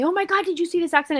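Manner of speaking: imitating an excited coworker, high and fast; quick, high-pitched retold gasp of news. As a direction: blurt it like a gossiping coworker. Coworker gossiping about crash.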